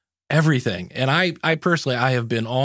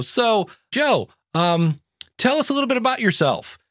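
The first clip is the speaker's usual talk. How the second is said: higher, sing-song host reading a stock question; lightly mocking interviewer voice, pitched up; bland podcaster intro; 9 semitones higher than usual. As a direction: mimic a lazy podcast host opening. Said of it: "they go".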